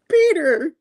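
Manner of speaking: high-pitched, loud call of a name; Mary Jane. Retold or imitated. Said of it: "And she's like".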